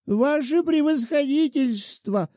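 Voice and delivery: a whining widow threatening with her rights; defenceless petitioner. A narrator's reading. Shchukina voiced by narrator.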